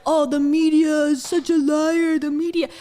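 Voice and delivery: a sneering online crowd; mocking the commenters. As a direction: drag out the jeering commenters. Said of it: "everyone's like".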